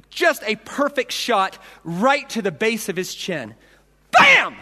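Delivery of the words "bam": high, loud sharp bam; mimicking the punch landing; punch sound. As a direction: smack the word like a punch. Imitating the punch.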